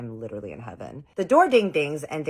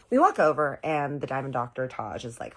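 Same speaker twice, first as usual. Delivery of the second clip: normal talking voice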